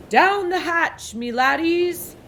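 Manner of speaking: loud, high, slow roaring toast; pirate yell. A narrator's reading. Captain toasts his crew at dinner, slow roaring yell. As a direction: bellow it while pounding the table. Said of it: "pounded his fist on the table and yelled".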